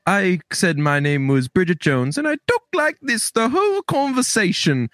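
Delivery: high, hammed-up British accent; Kelly Kapoor. Kelly Kapoor quote about a fake name, in hammed-up British accent. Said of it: "this was before Kelly Kapoor said".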